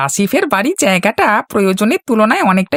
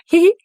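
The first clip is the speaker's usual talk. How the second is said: sly giggle; 7 semitones higher than usual. Acted. Sly henchman after scheming.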